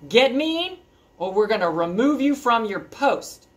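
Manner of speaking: a hint of stern bossy letter writer; troll patrol threat. Troll patrol letter warning the troll, lightly stern. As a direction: read the letter like a gruff boss. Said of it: "The troll patrol".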